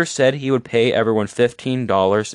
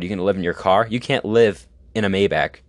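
ordinary talk, nothing marked

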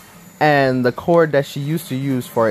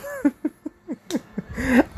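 real laugh; 13 semitones higher, 5 dB quieter than usual. Real, not acted. Laughing at the memory.

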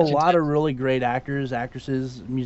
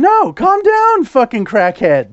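much higher, louder, slow yell; 6 semitones higher, 6 dB louder, slower than usual. Real, not acted. Telling his ranting friend to calm down, slow loud yell. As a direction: drag out a mock-outraged shout.

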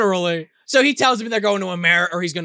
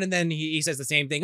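normal talking voice

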